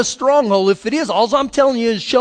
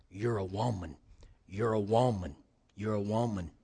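soft affirmation; 12 semitones lower, 14 dB quieter than usual. Retold or imitated. Counselor's repeated affirmation.

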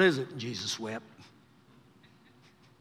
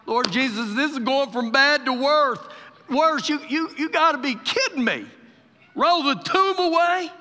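incredulous, raised-pitch protest; preacher's hammed-up dismay, climbing higher; Martha/character; 8 semitones higher, 5 dB louder than usual. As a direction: act out loud disbelief. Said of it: "Martha's looking like".

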